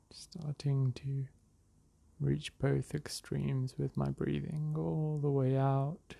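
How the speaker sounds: unhurried meditation voice with long pauses; guided meditation. Real, not acted. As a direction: ease them into the breath, drawing words out. Leads a breathing meditation.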